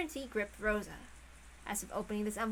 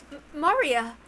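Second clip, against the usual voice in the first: flustered; 4 semitones higher, 7 dB louder than usual. Acted. Rosa startled by Maria.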